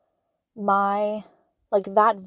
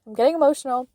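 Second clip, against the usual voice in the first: a touch choked up, higher and louder; getting emotional; 4 semitones higher, 5 dB louder, much faster than usual. Real, not acted. Host moved by her own story, real emotion rising. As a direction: let real emotion crack through. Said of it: "Whew, I'm getting emotional".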